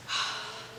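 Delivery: soft unvoiced sigh of impatience; weary sigh. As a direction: let out a long impatient sigh. Juliet waiting impatiently.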